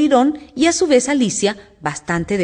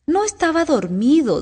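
slow, higher-pitched feeble protest; lightly voiced sleepy protest; weak, hoarse; 8 semitones higher than usual. A narrator's reading. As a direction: protest weakly, drawing it out. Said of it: "dijo con voz débil y enronquecida".

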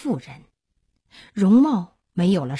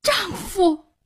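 very high-pitched cry to a husband; tearful recognition cry; 13 semitones higher than usual. Acted. Weeping wife recognising her long-lost husband, high emotional cry. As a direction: cry out as the tears come.

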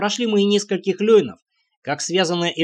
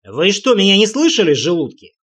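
raised, louder burst, dropping at the end; barked reprimand; 4 semitones higher, 5 dB louder than usual. A narrator's reading. Leader scolding men who ignored his order, barked reprimand. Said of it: "сделал небольшую паузу и рявкнул".

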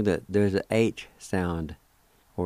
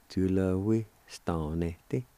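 "Julehuistanete" in two slow chunks; broken down slowly; slower than usual. Shown as a demonstration. Teacher making a long word simpler, broken down slowly. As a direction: ease through Julehuistanete part by part. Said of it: "Break it down something like that".

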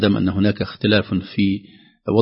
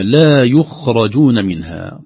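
لا يخرجون منها very slowly and loud; slow recitation; 3 semitones higher, 6 dB louder, much slower than usual. Shown as a demonstration. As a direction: recite it drawn out. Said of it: "قد يتبادر للذهن". (تلاوة متأنية)

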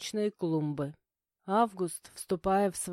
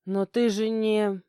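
hesitant protest; 3 semitones higher, 5 dB louder, slower than usual. A narrator's reading. Startled protest left unfinished.

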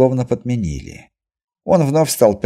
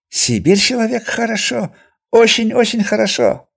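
high, eager broken-Russian trader; excited Chinese merchant; 13 semitones higher than usual. A narrator's reading. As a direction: beam and chirp it out.

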